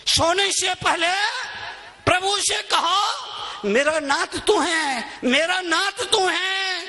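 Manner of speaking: high, ringing devotional call; proclaimed prayer. Real, not acted. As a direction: lift the prayer up for the crowd. Same prayer, proclaimed to crowd. (ऊँचे स्वर में प्रार्थना की पुकार)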